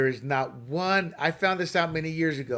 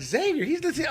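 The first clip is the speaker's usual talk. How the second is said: mock surprise; 11 semitones higher, faster than usual. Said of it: "I'm like".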